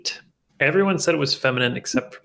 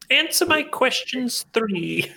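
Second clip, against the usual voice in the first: high, slow, hammed-up questioning voice; mock quizzical plea; 11 semitones higher, much slower than usual. Shown as a demonstration. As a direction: play a puzzled inquirer, pitched way up. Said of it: "three to me sounds quizzical".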